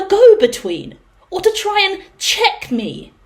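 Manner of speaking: hammed-up righteous fury at the caller; a big theatrical telling-off; indignant rant. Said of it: "And I'm like".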